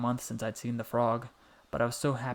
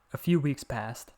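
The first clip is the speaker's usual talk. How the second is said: usual speaking voice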